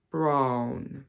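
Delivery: 'brown' with an RP vowel; RP accent. Shown as a demonstration. Teacher contrasting RP with her own accent, RP accent. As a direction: RP accent, placed on the bottom lip. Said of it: "show you the difference between".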